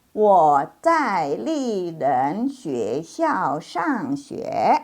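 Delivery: very slow, word by word Chinese sentence; slow reading demo. Shown as a demonstration. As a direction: read each word slowly for learners. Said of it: "Masih ingat?".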